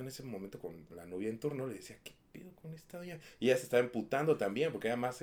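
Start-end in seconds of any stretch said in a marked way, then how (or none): 2.0-3.2 whispered aside in a dark cinema; an annoyed little whisper, barely voiced. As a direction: hush it to your date, annoyed. Muttering to girlfriend in cinema.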